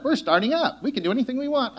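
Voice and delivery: high, giddy cheer breaking into a laugh; giddy startup joy. Retold or imitated. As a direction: play the naive startup crew, delighted. Startup team's naive joy.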